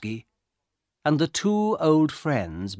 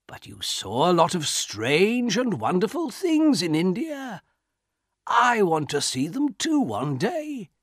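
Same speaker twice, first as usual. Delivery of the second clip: put-on higher, lilting old man's wish; 9 semitones higher than usual. A narrator's reading. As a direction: press on with wistful eagerness.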